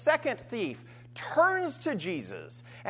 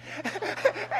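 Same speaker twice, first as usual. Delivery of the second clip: laugh; 13 semitones higher than usual. Real, not acted. Laughing at own joke.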